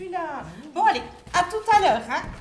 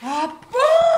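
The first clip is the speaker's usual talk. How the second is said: surprised; 6 semitones higher, 5 dB louder than usual. Acted. Surprised to see extras.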